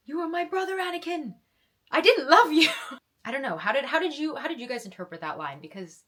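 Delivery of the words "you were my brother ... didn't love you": high, loud mock-dramatic Obi-Wan; Obi-Wan impression. Parodying Obi-Wan's farewell to Anakin, hammed-up and high. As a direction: ham up the betrayal speech. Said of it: "reverse Obi-Wan Kenobi".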